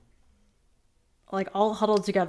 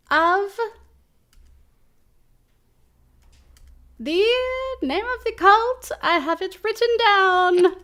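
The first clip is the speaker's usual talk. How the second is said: earnest cultist; 11 semitones higher, 3 dB louder than usual. Acted. Cultist at the Winnebago door asks for the baby, high earnest voice. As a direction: announce yourselves like an awkward cult envoy.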